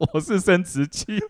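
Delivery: lisping, high and loud through laughter; mock a lisp while laughing; lisping impression. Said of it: "我模仿他大舌头讲话".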